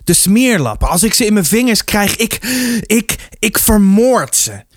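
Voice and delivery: high, halting, tearful threat; sobbing rage. A narrator's reading. Beaten boy raging to his mother, sobbing. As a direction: stammer the threat through sobs, pitched high. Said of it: "had het snikken nog niet onder controle".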